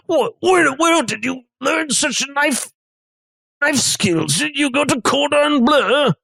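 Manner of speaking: high-pitched, amazed chef character; impressed chef. Acted. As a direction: question the underling as an impressed chef. Game master voices impressed chef.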